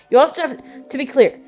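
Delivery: usual speaking voice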